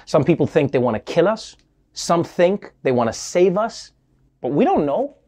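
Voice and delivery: ordinary talk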